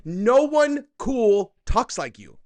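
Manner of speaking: loud, high, slowly stretched mockery; mocking their inflection. Host jeering at the woman's way of talking, loud drawn-out mock inflection. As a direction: hammer the annoying lilt, stretching every word. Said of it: "something about their inflection".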